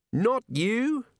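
high, irritated snap; snapping. A narrator's reading. Wizard calling up the steps to Rincewind, snapping. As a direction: dismiss him with a high snap. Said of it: "snapped the wizard".

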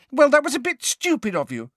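high, loud angry rebuke. A narrator's reading. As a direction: let him have it, furious. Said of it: "said Ginny angrily".

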